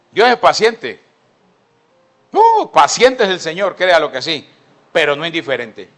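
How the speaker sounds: proclama alto y fuerte; predicación fervorosa. Real, not acted. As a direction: grita la verdad a la congregación. Predicación a plena fuerza. (predicador clamando con fuerza)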